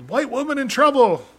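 mock yell. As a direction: pretend to raise the alarm in a high voice. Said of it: "when kids yell it".

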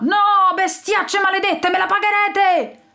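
high, hammed-up villain's cry; furious yell. A narrator's reading. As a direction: scream defeat while sinking. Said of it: "urlava Lord Panzone". (urlo disperato e acuto)

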